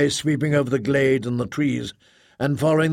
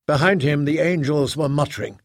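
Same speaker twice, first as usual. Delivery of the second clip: usual speaking voice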